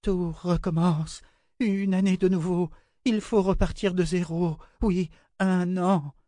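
frightened high-pitched babbling; terrorised child. A narrator's reading. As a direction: wail it high, as if entranced. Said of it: "comme une enfant terrorisée".

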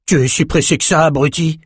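angry outburst without real shouting; exploding in anger. A narrator's reading. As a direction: snap at a cellmate, mildly hammed-up. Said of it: "explosa Brock".

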